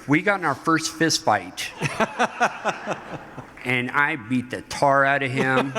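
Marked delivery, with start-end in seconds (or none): 1.8-3.6 high falling laugh; a delighted chuckle. Real, not acted. Laughing about the fight with his brother, high laugh.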